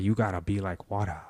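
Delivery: imitated thick Chinese accent; Bruce Lee. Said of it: "He had a thick ass accent".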